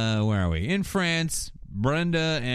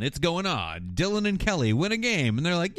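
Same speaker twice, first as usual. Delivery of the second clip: usual speaking voice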